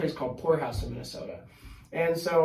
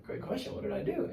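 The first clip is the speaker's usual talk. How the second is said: sheepish aside; 8 dB quieter than usual. Retold or imitated. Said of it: "And I'm like".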